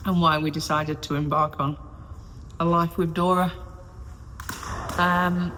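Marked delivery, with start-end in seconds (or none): none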